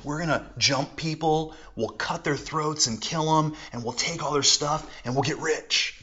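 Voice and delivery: hushed gang invitation; sneaky robbers. Retold or imitated. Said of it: "the highway robbers are like".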